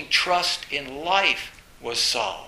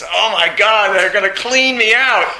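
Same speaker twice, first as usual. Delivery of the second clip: loud, high retold horror; husband realizing he'll be ruined; mock dismay; 6 semitones higher, 14 dB louder than usual. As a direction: act out his sudden dread.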